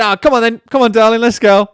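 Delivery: brisk cheery let's go, darling; chivvying. Retold or imitated. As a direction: hurry your partner along out loud. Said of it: "I just said".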